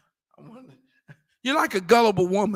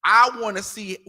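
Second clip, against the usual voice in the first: ordinary talk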